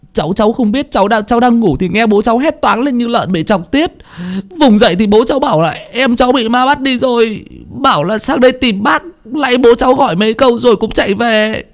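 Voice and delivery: put-on high, stammering child's voice; scared boy. Boy explaining to old Hà what happened, scared. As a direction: explain nervously like a frightened kid.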